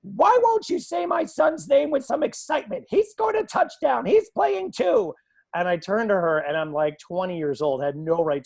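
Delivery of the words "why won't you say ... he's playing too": high, loud, outraged parent's rant; berating mother. Retold or imitated. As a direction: imitate an irate mom berating the announcer. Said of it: "she berates me".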